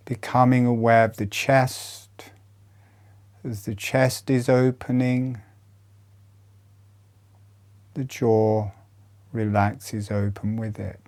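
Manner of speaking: soothing body-awareness cues; guided meditation. Acted. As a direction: talk them through each breath, unhurried. Leads a chest breathing meditation.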